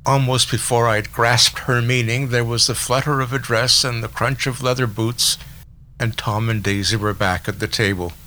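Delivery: usual speaking voice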